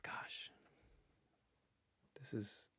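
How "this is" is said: normal talking voice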